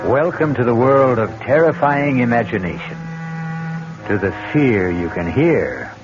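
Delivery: a horror show host welcoming listeners into the dark; spooky host narration. Acted. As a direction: savour every dread word like a horror host. Radio mystery host intro.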